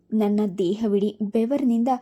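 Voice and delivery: plain reading voice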